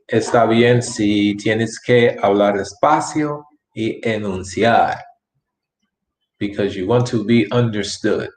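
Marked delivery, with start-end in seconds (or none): none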